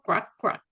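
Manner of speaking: two short, separate "crack, crack" sounds; quick double "crack", each cut short; short croaks. Shown as a demonstration. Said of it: "el sonido más corto".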